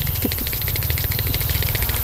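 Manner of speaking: rapid whispered repeats; fast whispering. Acted. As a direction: whisper it quickly, close to the mic. Unvoiced rapid goods.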